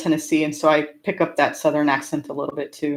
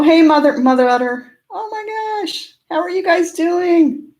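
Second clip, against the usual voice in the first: excited greeting; 13 semitones higher, 3 dB louder than usual. Real, not acted. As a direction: squeal a big hello to dear friends, voice way up. Greeting friends who just joined stream.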